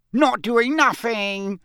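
high, slow, impish taunt; cackling Peeves. Acted. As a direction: cackle it like a mischievous poltergeist. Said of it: "cackled Peeves".

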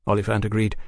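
ordinary delivery

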